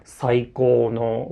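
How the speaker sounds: "saikou no" very slow, first mora low, the rest and "no" high; low-high pitch accent. Shown as a demonstration. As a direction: spell out "saikou no" beat by beat, low then high. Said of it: "first pitch is low and all the remaining pitch are high".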